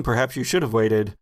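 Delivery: ordinary talk, nothing marked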